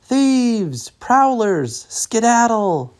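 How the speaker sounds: high, loud yell to chase thieves off; shouting at thieves. A narrator's reading. Farmer chasing raccoon thieves off, high loud yell. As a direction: shoo the intruders away with a high, loud cry.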